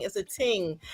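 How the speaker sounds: "ting" with a hard t, lightly Caribbean; a playful touch of Caribbean accent on "ting". Shown as a demonstration. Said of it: "in my Caribbean voice".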